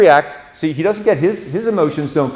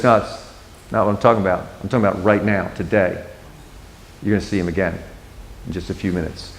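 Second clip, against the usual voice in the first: soft, tender assurance; 7 semitones lower, 6 dB quieter, much faster than usual. Retold or imitated. Jesus gently explaining to Martha.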